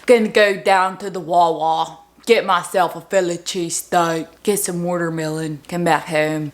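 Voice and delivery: put-on playful Delco accent; Delaware County accent. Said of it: "I'm starting to mix in a Delaware County accent".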